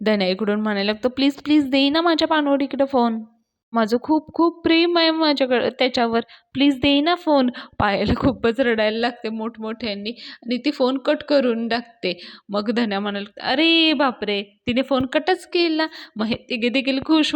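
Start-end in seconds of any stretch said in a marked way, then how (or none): 1.1-7.5 high, mildly hammed-up pleading; pleading girl. Fake girlfriend begs for her beloved, high insistent plea. 13.5-15.9 high, drawn-out astonishment; surprised exclamation. Acted. Dhanya sees the call cut, high drawn-out surprise.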